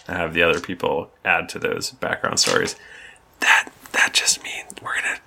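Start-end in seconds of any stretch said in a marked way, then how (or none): 3.4-5.2 whispered aside to the listeners; hushed aside, fast at the end. Real, not acted. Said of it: "who are you telling that".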